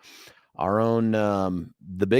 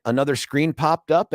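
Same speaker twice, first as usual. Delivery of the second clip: ordinary delivery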